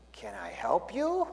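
put-on slow, hushed Chinese-accented question; a lightly done Chinese accent, slow and hushed; thick Chinese accent. Pastor's joke, Chinese usher greets visitor in accent. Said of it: "says in really thick accented English".

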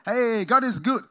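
loud hammed-up hallelujah; mock cheerful preacher. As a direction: play to the crowd, mock-bright. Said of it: "get up there and say".

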